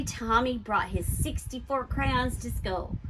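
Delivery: ordinary delivery, nothing marked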